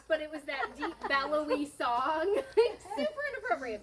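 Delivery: high, loud, laughing through the words; real amusement bubbling over as you tell it. Real, not acted. Daughter recalling dad's inappropriate song to baby, laughing.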